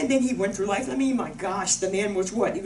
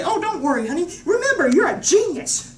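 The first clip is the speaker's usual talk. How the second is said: high, loud reassurance; cheerleading mother; 8 semitones higher, 7 dB louder than usual. Retold or imitated. Mother tells honey not to worry, upbeat. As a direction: build up to a big, gushing finish.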